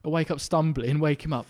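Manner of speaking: ordinary delivery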